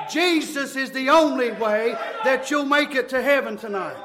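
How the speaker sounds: driving, rhythmic revival sermon; full-force preaching. Real, not acted. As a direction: preach it hard without letting up.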